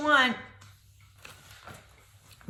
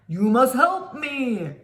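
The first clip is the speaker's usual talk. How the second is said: monster voice; 3 semitones lower, 3 dB louder than usual. Acted.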